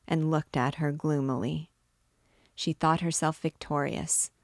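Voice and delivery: ordinary delivery, nothing marked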